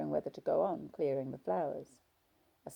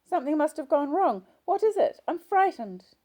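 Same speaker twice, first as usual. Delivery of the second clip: frightened; 13 semitones higher, 10 dB louder than usual. A narrator's reading. Frightened patient pleading with nurse.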